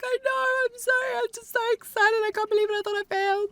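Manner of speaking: high, loud, breathless squeal through happy tears; squealing overjoyed. Retold or imitated. Telling the testing lady she passed, overjoyed high squeal. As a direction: gush it out like you can't believe your luck. Retold overjoyed crying reply.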